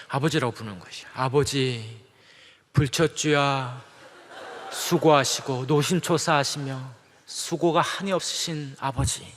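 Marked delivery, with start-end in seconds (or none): 1.2-9.3 slow, overly formal pleading to father; lightly voiced stilted child asking for money; mock formal plea. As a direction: ham up a stiff ceremonious request. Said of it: "이렇게 얘기하면 그 이상하지 않습니까".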